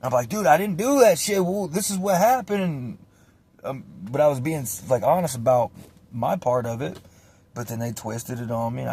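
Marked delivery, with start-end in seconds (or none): 0.3-2.6 high, loud defensive denial; retold protest. Kid explaining himself on a serious case, high retold protest. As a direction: plead your side, pitched up.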